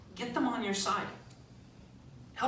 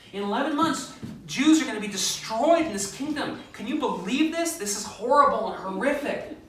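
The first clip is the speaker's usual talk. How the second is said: much higher, loud alarm; 8 semitones higher, 7 dB louder than usual. Retold or imitated. Esther rousing the king with loud, high alarm. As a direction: sound the alarm to stir him. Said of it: "gets riled up about the problem".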